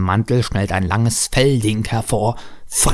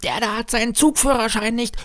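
put-on child yelling; 13 semitones higher than usual. A narrator's reading. Boy telling everyone about the driver, high mock yell. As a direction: yell like a small boy with volume held back. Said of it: "Lennart brüllt".

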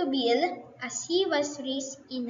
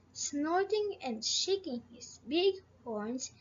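plain reading voice

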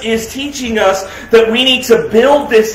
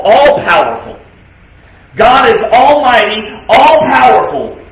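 preaching; 3 dB louder, slower than usual. Acted. Preaching God's attributes, slow.

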